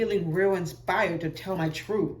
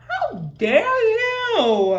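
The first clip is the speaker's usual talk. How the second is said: very slow, loud, high, theatrical; girl playing scandalized at the boy's audacity; mock outrage; 14 semitones higher, 7 dB louder, much slower than usual. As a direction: ham up the offended diva. Mock offended.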